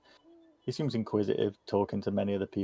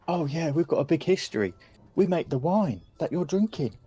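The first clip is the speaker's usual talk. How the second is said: put-on higher-pitched chatty child voice; proud kid; 7 semitones higher than usual. Kid tells guests about the family wine, chatty child voice. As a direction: tell it like an eager youngster.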